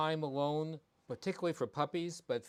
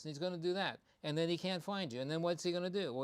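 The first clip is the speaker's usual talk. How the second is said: usual speaking voice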